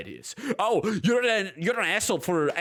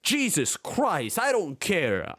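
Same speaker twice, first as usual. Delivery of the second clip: normal talking voice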